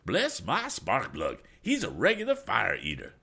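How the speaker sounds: a hint of a jovial old gentleman; cheerful old gent. A narrator's reading. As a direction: tease the officer, unbothered. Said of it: "went on Mister Damon".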